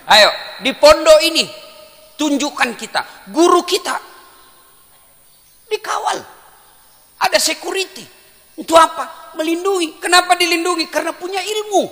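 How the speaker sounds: high, loud rallying preacher voice; full-force preaching. Acted. Preacher rousing the students about their guarded teacher, full-force preaching. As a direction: raise the pitch and drive the questions home.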